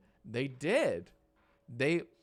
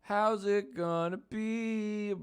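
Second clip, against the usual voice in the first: dramatic announcer; 10 semitones higher, 5 dB louder, much slower than usual. Acted. Dramatic poll question.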